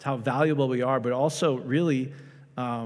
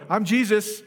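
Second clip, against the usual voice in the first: higher-pitched mock announcement to the room; mock proclamation; 8 semitones higher, 4 dB louder, slower than usual. Preacher pretending to disrupt a service, mock proclaiming. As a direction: proclaim it brashly, as a pretend interruption. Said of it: "It would be like me going".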